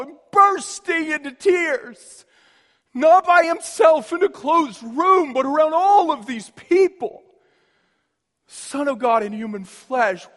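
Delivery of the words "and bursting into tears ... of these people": higher and much louder, building to full force; rising to a shout. Acted. Preacher to congregation on Jesus weeping in public, building to a shout. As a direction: let it erupt, louder with every phrase.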